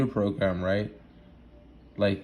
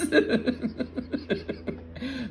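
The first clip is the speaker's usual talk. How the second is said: high-pitched giggling laugh; high giggle. Real, not acted. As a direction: let out a squeaky laugh. Laughs at own claim.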